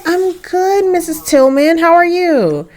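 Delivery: high, polite greeting voice; high-pitched courteous hello; polite girlfriend. Acted. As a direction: answer the mom in a high, sweet voice. Girlfriend greets boyfriend's mother back.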